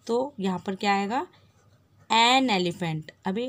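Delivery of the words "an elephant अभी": ordinary delivery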